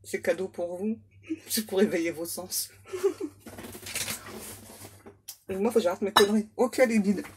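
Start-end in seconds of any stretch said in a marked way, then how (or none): 2.9-4.5 soft, high, airy giggle; quiet giggle. Real, not acted. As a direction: giggle under your breath at your own joke. Quiet laugh at her own joke.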